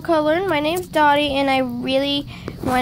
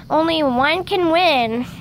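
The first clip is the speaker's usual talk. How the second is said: ordinary delivery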